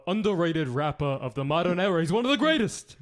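rally-style Bernie Sanders praising [name]; Bernie Sanders impression. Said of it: "It's a pretty good Bernie".

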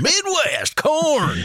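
high, hammed-up Midwestern bumpkin; mock Midwest governor. Midwest governor touting home to a UK crowd, high hammed-up folksy voice. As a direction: proudly announce it in a high cartoon voice. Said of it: "the only Midwest governor".

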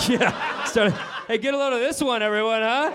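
high, giggly mock heckle; snickering inner child. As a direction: nudge the room like a snickering kid. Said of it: "And instantly I'm like".

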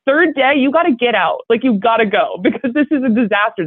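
ordinary talk

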